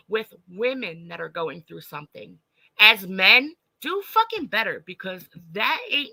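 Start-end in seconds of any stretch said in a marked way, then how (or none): 3.8-4.8 high, forceful demand; angry demand. Real, not acted. Scolding the men on the panel, angry. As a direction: scold them hard from above.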